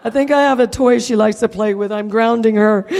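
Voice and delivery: loud, joking through laughter; laughing mock scolding. Real, not acted. Owner joking about disciplining her dog, laughing. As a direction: scold playfully while laughing.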